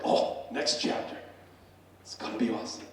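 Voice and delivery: hushed, breathy anticipation; retold eagerness. Husband coming home to his wife's next chapter, hushed acted eagerness. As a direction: half-whisper it with relish. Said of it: "I'm like".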